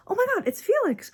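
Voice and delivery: high gasping squeal; excited gasp. Real, not acted. Gasps at pulling favourite card.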